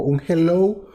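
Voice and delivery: «hello» con subida de tono al final, como preguntando. Shown as a demonstration. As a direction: di «hello» como si dudaras quién contesta. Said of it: "un hello así como de pregunta". («hello» interrogativo, subiendo al final)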